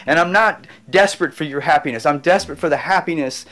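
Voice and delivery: loud, fervent appeal to the audience; impassioned preaching. Real, not acted. Urging viewers to go get their happy, preached loud. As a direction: preach it from the heart, full force.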